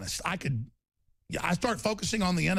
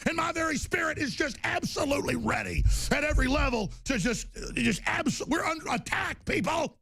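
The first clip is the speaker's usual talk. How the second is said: 1 furious shout; 11 semitones higher than usual. Real, not acted. Ranting at listeners.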